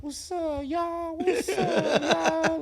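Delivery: high-pitched mock greeting; put-on high voice, playfully imitating a friend; imitating swaggering friend. Imitating his friend strutting and greeting.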